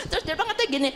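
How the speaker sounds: usual speaking voice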